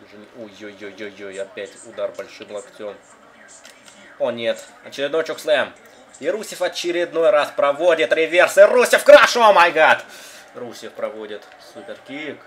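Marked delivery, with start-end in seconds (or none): 4.2-10.0 excited shout. Real, not acted. Big move in the game.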